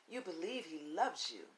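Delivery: ordinary talk, nothing marked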